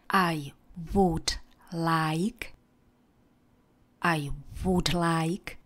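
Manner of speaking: slow, careful I would like with weight on would; slow teaching read. Shown as a demonstration. Teacher slowly models I would like for the class. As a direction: spell it out slowly for learners, leaning on would. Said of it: "a přidáte jenom would".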